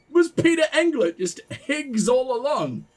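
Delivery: ordinary talk, nothing marked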